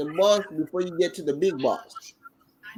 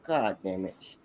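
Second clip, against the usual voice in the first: stunned mutter; 11 semitones lower, 7 dB quieter than usual. Retold or imitated. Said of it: "I'm like".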